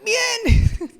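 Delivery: high, loud forced bright bien; fake fine. Shown as a demonstration. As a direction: chirp a cheerful lie. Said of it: "Sigo mintiendo".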